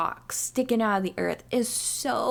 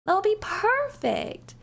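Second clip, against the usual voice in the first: mock eager; 10 semitones higher, slower than usual. Mocking own eager group.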